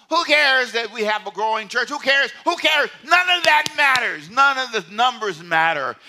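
high, loud preaching climax; preaching shout. Real, not acted. Pastor to congregation, preaching loud that numbers mean nothing.

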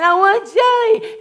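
put-on high, slow whiny child wail; a whining two-year-old; crying child. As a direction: play the toddler begging for jelly. Crying child voiced in story.

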